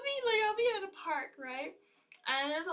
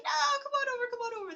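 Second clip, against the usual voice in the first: high, louder imitation of urging; coaxing insistence; 12 semitones higher, 6 dB louder, faster than usual. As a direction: wave someone over, playfully insistent. Old men coaxing her over.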